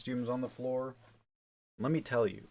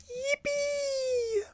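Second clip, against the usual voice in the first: extremely high, held squeal of yippee; mock cheer in a piercing falsetto; as high as possible. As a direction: push to the very top of your range. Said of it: "That's as high as I can go".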